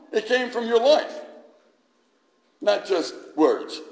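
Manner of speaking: high, loud impassioned preaching; fiery preaching. Acted. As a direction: preach it out with full conviction. Preaching at full force.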